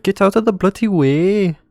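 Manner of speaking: high, loud mock road-rage mum; imitating a British mum. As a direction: ham up an angry British mother stuck in traffic. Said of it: "Does she say it like that?".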